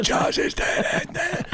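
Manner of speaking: strangled, drawn-out voiceless croak; mock dying joke. Comedian joking while suffocating, mock choking. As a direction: choke the joke out slowly as if suffocating. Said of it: "still went out doing a joke".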